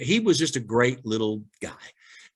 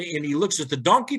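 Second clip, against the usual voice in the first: ordinary talk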